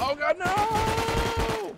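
long, high, loud wailing no; anguished scream. Real, not acted. Player crying out to God in game, anguished scream. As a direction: scream it out and hold the last word.

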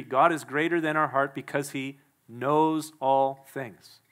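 ordinary talk

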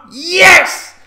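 loud high whoop of joy; real elated cheer. Real, not acted. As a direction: throw your arms up and yell yes. Cheering the contract news.

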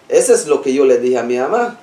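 ordinary talk, nothing marked

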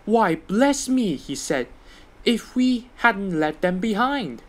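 slow, high, drawn-out dismay; dismayed surprise. A narrator's reading. Thomas discovering he left his coaches behind. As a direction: let the realisation dawn slowly.

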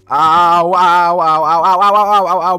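loud, repeated ow ow ow; loud string of real pained yelps. Real, not acted. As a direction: yelp at each hit. Getting hit by zombies.

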